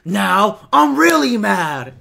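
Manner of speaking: loud, very drawn-out mock anger; hammed-up rage breaking toward a laugh; mock mad catchphrase. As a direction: stretch it out like a catchphrase. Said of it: "Chad, who says that?".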